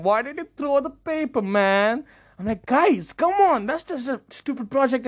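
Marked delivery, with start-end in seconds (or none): 0.1-2.0 disappointed whine. Retold or imitated. Disappointed classmates complaining. 2.7-5.1 high-pitched, insistent protest; exasperated appeal. Retold or imitated. Acted exasperated plea to classmates.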